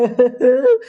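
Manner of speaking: short gloating cackle, rising; villain laugh. Acted. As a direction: cackle at her helplessness. Villain gloats over girl. (खलनायक की हँसी)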